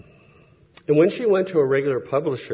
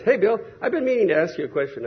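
coworker's quick question; 7 semitones higher, faster than usual. Retold or imitated. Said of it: "and he goes".

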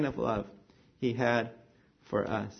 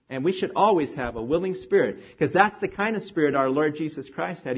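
ordinary talk, nothing marked

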